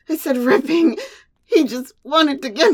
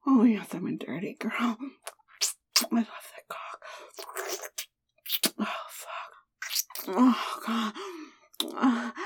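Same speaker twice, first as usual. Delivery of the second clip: very soft, voiceless murmur; whisper; 4 semitones lower, 13 dB quieter than usual. Acted. Wife murmuring to her lover, whispering. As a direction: whisper it low, barely audible.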